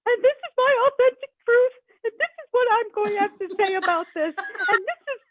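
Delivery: high, loud, hammed-up proclamation; mock grand declaration. Ranting to her husband, hammed-up and loud. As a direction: play it like a self-important speech. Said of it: "I've been like".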